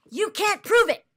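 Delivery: loud, high, mocking the stock reply; angry mock echo. Real, not acted. Streamer mocking chat's reply, angry and loud. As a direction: spit back the phrase in disgust. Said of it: "I'm getting angry".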